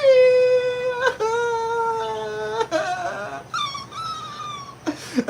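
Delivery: high, long cackling laugh; shrieking laughter. Real, not acted. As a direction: laugh helplessly at your own bit. Laughing after mock scream.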